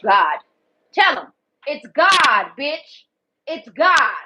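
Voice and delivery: loud emphatic declaration; loud proclamation. Real, not acted. To viewers who ask how she gets help, loud insistence. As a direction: declare it with force.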